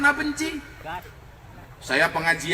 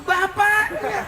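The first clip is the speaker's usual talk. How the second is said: drawn-out punchline; 12 semitones higher, much slower than usual. Real, not acted.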